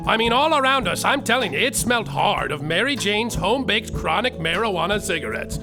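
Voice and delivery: ordinary talk, nothing marked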